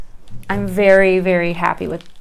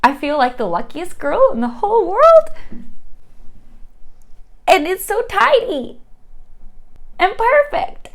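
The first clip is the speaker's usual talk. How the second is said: excited gushing; 10 semitones higher than usual. Real, not acted. Gushing over new plant.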